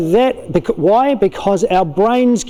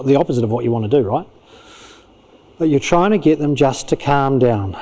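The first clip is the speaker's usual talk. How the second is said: ordinary talk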